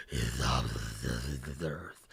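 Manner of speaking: mocking the gravelly movie Batman. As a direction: rasp it deep and menacing like Bale's Batman.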